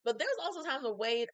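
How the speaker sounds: normal talking voice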